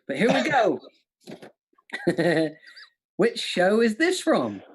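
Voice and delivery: ordinary delivery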